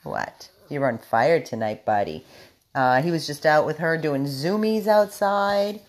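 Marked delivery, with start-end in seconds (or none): none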